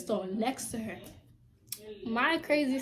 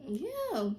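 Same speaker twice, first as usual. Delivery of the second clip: ordinary talk, nothing marked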